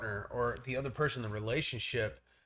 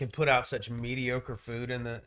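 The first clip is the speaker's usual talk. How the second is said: usual speaking voice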